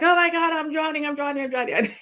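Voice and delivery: retelling her lazy-river scare as a high panicked wail; mock panic. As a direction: replay the drowning panic high and frantic. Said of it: "when you can't swim, you're panicking".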